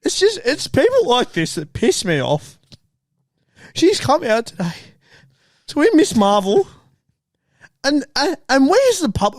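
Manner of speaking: mock emotional. Reporter gets mock emotional about the carnival injustice. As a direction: play it like you're close to tears over nothing. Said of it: "I know I'm getting emotional".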